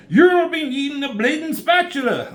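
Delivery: high, loud hammed-up British accent; a cartoonish Cockney jab; bad British accent. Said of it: "That's not the accent he has".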